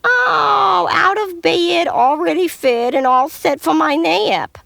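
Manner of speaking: high, loud chirpy reply; excited pig. Acted. Little pig boasting to the wolf, high and loud. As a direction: squeal it out proudly.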